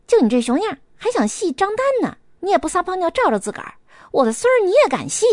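rip into her with scorn; scolding insult. Acted. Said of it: "骂道".

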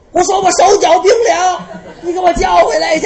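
mock shout. Character shouts demand in storytelling.

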